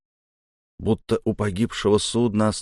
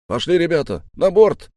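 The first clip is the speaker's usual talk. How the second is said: high, loud call building to the end; rallying shout; 10 semitones higher, 6 dB louder than usual. Acted. Leader calls the crew aboard, rallying shout. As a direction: call the men on, loudest on the last words.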